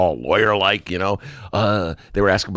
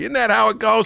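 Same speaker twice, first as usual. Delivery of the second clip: normal talking voice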